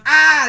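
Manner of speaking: mock pained yelp. [name] shoots own foot.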